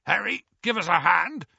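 stuck giant calling hoarsely. Acted. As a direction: call for help hoarsely. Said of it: "called Hagrid hoarsely".

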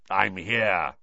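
put-on growled, wheezy old voice. A narrator's reading. Moody turning up to answer Dumbledore, wheezy growl. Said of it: "said a wheezy growl".

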